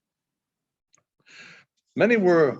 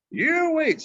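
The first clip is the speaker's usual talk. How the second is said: much higher, eager interjection; Baldy the mail rider; 11 semitones higher than usual. Acted. Mail rider hushing the grumbling bar crowd, eager and high. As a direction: cut in to hold the room's attention.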